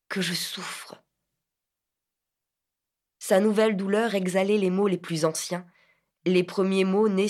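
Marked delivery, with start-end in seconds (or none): 0.1-1.0 breathy whisper of pain; sighing whisper of suffering. A narrator's reading. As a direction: whisper it like a sigh. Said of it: "comme il eût soupiré".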